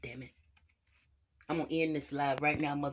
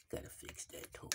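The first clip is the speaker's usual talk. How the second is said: whisper; 19 dB quieter than usual. Acted. Aside while fixing toe.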